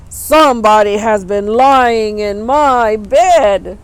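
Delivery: loud slow booming papa bear; great big bear voice. A narrator's reading. Papa bear finds his pillow moved, loud slow booming. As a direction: rumble it out slowly like a huge bear. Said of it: "in his great big voice".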